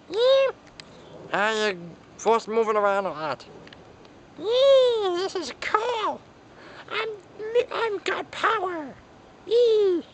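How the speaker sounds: a tiny excited figure squeaking; squeaky Lil T voice. Acted. Lil T answers the boss, squeaking high.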